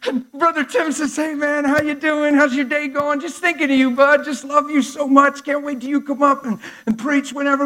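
high, loud, slowed preacher delivery; booming preaching. Real, not acted. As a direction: drive it home to the congregation. Preaching at full force.

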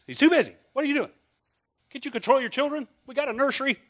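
mock-irritated usher telling parents off; scolding disciple.

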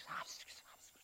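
whispered imp glee; tiny, voiceless imp aside; gleeful imp. Acted. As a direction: whisper it like a sneaky little minion. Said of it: "He goes over and he's".

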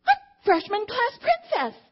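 high, slow, beaming announcement; thrilled daughter. Retold or imitated. Daughter telling mom she won princess, high and thrilled. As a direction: draw it out like the biggest news ever.

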